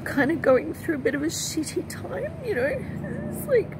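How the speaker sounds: high, tearful voice; trying not to cry. Real, not acted. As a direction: push haltingly through real tears. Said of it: "I don't mean to cry".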